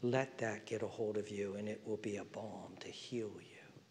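tender whisper. Real, not acted. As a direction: soothe them with a secret. Whispered promise of healing.

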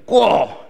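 loud, high raven squawk; sudden cartoon bird call; raven caw. Raven character calls out.

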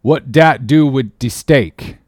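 slow, testy dialect retort. A narrator's reading. Cook retorts to Stubb's question, testily. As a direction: answer with drawn-out irritation.

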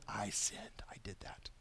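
hushed confession in a whisper; David whispering his confession. Retold or imitated. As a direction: whisper it like a broken king. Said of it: "David's like".

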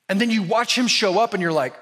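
usual speaking voice

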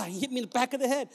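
ordinary delivery

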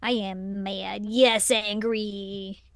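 low, slow, subdued mock anger; deadpan mock anger. Co-host mocks the angry character, deadpan and low. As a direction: play anger slowly and quietly, as a joke.

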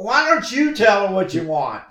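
mock-exasperated father; exasperated dad. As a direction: throw it back at her. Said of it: "I said".

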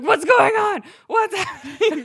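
husband woken by screaming, shouting in alarm; startled shout. Retold or imitated. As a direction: yell like you were jolted awake. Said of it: "lurches out of bed".

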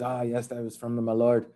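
low, soft, rough attempt at Irish; lightly faked Irish accent. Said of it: "that's my Irish accent".

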